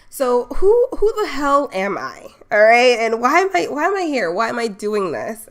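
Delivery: loud, high, punchy rhetorical question; hyped self-intro. Real, not acted. Host to live chat, loud excited rhetorical intro. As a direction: pump up the room as you introduce yourself.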